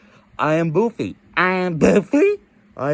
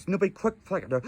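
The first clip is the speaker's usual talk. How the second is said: normal talking voice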